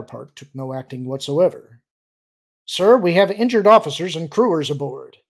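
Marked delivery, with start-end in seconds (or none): 2.6-5.2 loud, pleading report; bluffing officer pleads with the admiral, louder; bewildered fear. Acted. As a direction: push it out, louder and anxious. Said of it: "mix respect and bewildered fear into his tone".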